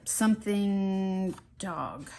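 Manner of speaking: normal talking voice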